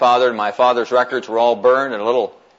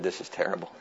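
dismayed hush; 7 semitones lower, 14 dB quieter, faster than usual. Retold or imitated. Archivist reluctant to tell bad news.

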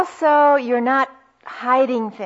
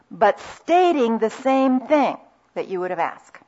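usual speaking voice

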